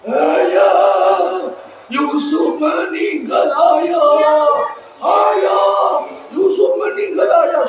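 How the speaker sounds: high, hammed-up wail; fake crying. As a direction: wail for father in drawn-out mock grief. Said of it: "sambil nangis-nangis".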